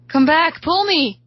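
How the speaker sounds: high, loud desperate yell. A narrator's reading. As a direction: yell urgently for someone to return. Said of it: "I yell".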